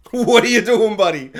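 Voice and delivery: loud, laughing "What are you doing"; laughing disbelief. Real, not acted. Vlogger laughing at fish-throwing peasant, loud disbelief.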